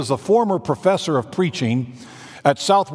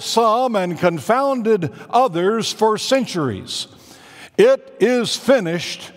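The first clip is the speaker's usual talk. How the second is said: higher, slow and grand preaching; Joel Gregory's voice; 5 semitones higher, slower than usual. Retold or imitated. Pastor quoting his mentor, lightly voiced grand preacher. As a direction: roll out every phrase like a great orator. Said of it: "that's the way he says it".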